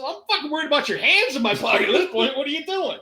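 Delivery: high-pitched, rattled objection; indignant protest. Acted. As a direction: protest to the cop, voice shooting up. Acted indignant protest to the cop.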